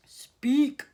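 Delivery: loud, booming alien voice; booming command. A narrator's reading. Alien loudspeaker booming at the glass elevator. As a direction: boom it out like a giant speaker. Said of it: "boomed the voice".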